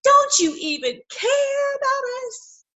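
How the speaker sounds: high pleading voice, mock desperate; pleading disciples. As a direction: beg him to wake up and care. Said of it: "they shake him and they say".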